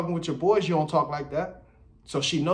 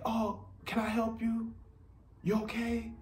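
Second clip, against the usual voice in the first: mock soft dude, high and slow; light, soft suitor voice; 9 semitones higher, 8 dB quieter, slower than usual. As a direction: put on a high, gentle, fawning voice. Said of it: "his voice get all light".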